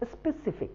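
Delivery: specific with clear s-p-e sounds; correct pronunciation. Shown as a demonstration. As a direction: model the word specific cleanly. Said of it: "the right pronunciation is". (सही उच्चारण में स्पष्ट)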